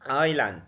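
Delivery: island as eye-land with no s; island with silent s; silent s demo. Shown as a demonstration. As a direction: leave out the s in island. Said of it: "ال S هنا ما بتتنطقش".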